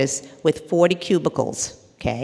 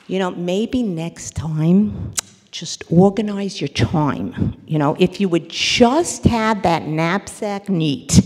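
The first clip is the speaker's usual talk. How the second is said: preachy advice; 6 dB louder than usual. Retold or imitated. Friend lecturing the upset woman, slow and preachy. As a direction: lecture her slowly, then climb. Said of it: "Okay, advice".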